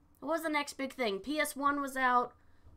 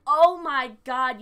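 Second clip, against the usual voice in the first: mock freaked-out mom; 3 semitones higher, 10 dB louder, slower than usual. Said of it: "She was like".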